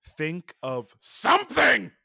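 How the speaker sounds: high, slow, drawn-out aggressive radio line; mock radio menace; gravelly menacing quote. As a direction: growl it out with aggression like the angry driver. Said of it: "I butchered the way that he says it".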